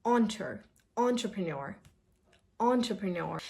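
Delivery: entrepreneur starting "ON-truh"; entrepreneur as "on-truh-pre-nor"; correct first sound. Shown as a demonstration. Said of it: "the first sound is not E".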